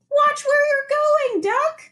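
high, slow fussy hen scolding; a lightly put-on high chicken grumble. Chicken's grumpy thought.

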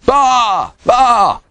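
loud, high sheep bleats; cried-out bleat. A narrator's reading. Pete the sheep having another idea, cried bleats. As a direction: cry out like a sheep. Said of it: "cried Pete".

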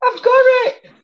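very high, loud cry of success; real high-pitched burst of triumph; triumphant squeal. Real, not acted. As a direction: squeal it out as you finally grab it. Straining to retrieve a lost paper.

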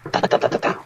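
tapped-out knock sounds in a whisper; knocking imitated. Boyfriend mimics girlfriend banging on bedroom door, whispered knock sounds. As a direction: tap out her knocking on the door, unvoiced.

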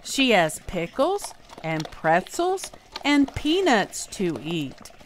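usual speaking voice

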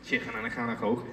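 fast Liverpool accent; Scouse accent. Shown as a demonstration. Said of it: "teach Elijah to do a Scouse accent".

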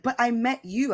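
insistent protest. Retold or imitated. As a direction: refuse to back down. Fan insisting, escalating argument.

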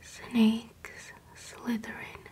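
normal talking voice

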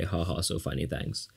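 sarcastic laugh. Real, not acted. Teacher mocks the cartoon's humor, low and soft. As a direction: mutter a dry ha ha. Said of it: "some kind of humor".

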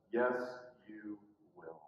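low, soft, slow reply; hushed, slow contradiction; quiet certainty. Retold or imitated. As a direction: answer gravely, with no force. Jesus answers Peter's protest.

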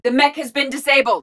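high-pitched status callout; urgent combat report. Acted. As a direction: report to the squad mid-fight.

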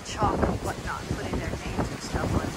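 ordinary delivery

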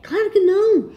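loud, high-pitched shocked refusal; emphatic refusal. Real, not acted. Mãe recusa pedido da filha. (recusa enfática, em voz alta)

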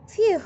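high, loud exhale of relief; the cat's loud relieved phew. A narrator's reading. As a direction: blow out a big phew like after a narrow escape. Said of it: "said the cat".

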